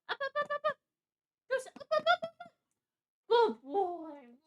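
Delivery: very high squeaky coaxing. Real, not acted. Streamer coaxes her dog onto her lap, squeaky high. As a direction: coax the dog up, squeaky and eager.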